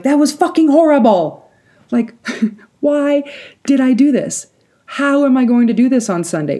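high, loud retold outburst; hammed-up exasperated wail to friends; exasperated venting. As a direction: blurt it out in dramatized dismay.

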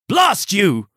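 acted indignant cry; angry shout. A narrator's reading. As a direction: curse him like a furious huntsman. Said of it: "he shouted".

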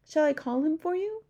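eager young girl, lightly voiced; Sophie's voice. A narrator's reading.

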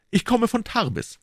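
high, quick cut-in; eager interjection; interrupting. Acted. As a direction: cut him off. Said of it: "fiel D'Artagnan ein".

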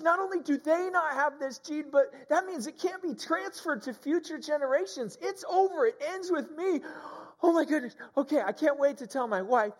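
high, loud ecstatic whoop easing into breathless thanks; overjoyed disbelief. Retold or imitated. Pastor reliving the kids' good test results, ecstatic disbelief. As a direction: shriek with joy, then gradually come down. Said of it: "so excited".

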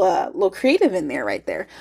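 ordinary talk, nothing marked

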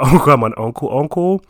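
ordinary delivery

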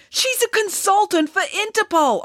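very high, heated protest; indignant high-pitched objection; arguing. A narrator's reading. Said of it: "argued Bernie".

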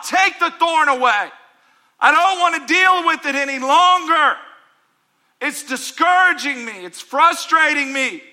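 plead, worn out and desperate; begging shout. Acted. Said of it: "Paul's begging God".

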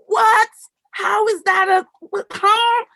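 high, loud, sputtering what; retold shock, mind blown; stunned disbelief. Retelling her stunned reaction.

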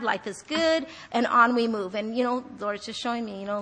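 normal talking voice

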